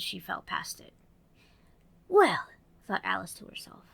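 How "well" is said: put-on high, bright little girl voice. Narrator voicing Alice, girlish and eager.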